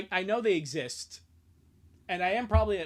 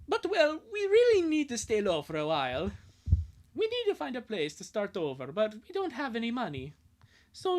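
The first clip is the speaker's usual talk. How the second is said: put-on higher, eager boy's voice, whoop on Wahoo; Lincoln voice; 5 semitones higher than usual. Lincoln asks old friend Angus for a big favour, high and eager. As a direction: play the boy begging a favour.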